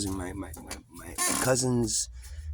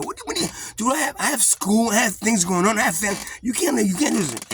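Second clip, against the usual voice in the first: much higher, rushed and pleading; frantic protest; 11 semitones higher than usual. Retold or imitated. Young man protesting to the commanding officer, high and frantic. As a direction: panic about being stuck in the army.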